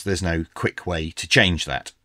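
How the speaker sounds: ordinary talk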